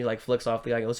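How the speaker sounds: ordinary delivery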